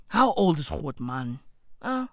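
ordinary talk, nothing marked

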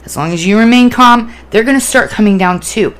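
usual speaking voice

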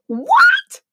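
high shrieking What; furious high shriek. Acted. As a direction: shriek it in rage. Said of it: "shrieked the queen in barely controlled rage".

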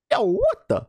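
very high startled yelp; stunned surprise. Retold or imitated. Fan seeing a surprise link in Discord, very high startled. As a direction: catch your breath in disbelief. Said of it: "and I'm like".

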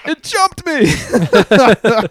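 high, very slow, drawn-out comic cry from under the bike; Calvin's muffled cry. Retold or imitated. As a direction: stretch it out high and loud like a kid pinned by a bike. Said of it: "and then his words".

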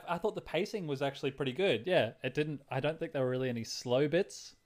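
ordinary talk, nothing marked

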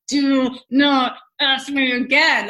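slow, loud, irritated snap; irritated warning. Retold or imitated. Pregnant wife warning her husband, slow and irritated. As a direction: warn him off, slowly and firmly. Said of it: "I just remember being like".

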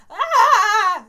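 loud scared scream; screech. Acted. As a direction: shriek in fright. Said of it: "screeched all his friends".

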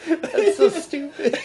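high, helpless laughter; laughing hard. Real, not acted. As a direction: crack up at the silly joke. Cracking up at the joke.

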